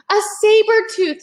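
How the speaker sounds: put-on high, loud startled boy; alarmed. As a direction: Jack spots the tiger. Jack spots the tiger.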